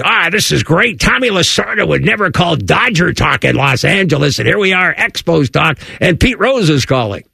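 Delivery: high put-on Squiggy voice, excited; Squiggy impression. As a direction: do Squiggy gushing over the call. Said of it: "That's the way he talked".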